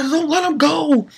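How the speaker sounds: high, desperate acted plea; pleading cry. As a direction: beg them in a high voice. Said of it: "Genozo on the ground says".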